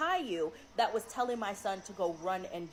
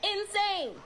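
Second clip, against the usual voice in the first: appalled; 11 semitones higher, slower than usual. Real, not acted. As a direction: let real alarm push the voice up. Mom reacts to son's story.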